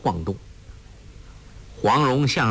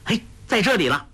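quick, higher-pitched cry of discovery; raised, hurried exclamation; calling out; 9 semitones higher, faster than usual. A narrator's reading. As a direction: call out fast that you've found it. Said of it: "立时叫道".

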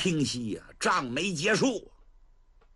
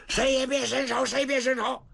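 commanding shout; 10 semitones higher than usual. Acted. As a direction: bark it to hold everyone back. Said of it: "程咬金就喊". (高声喝止)

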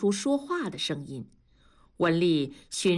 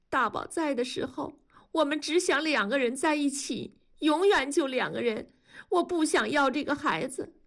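crying; 9 semitones higher than usual. A narrator's reading. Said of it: "卢山哭着说".

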